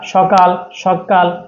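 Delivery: normal talking voice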